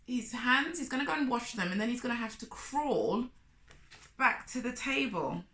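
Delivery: ordinary delivery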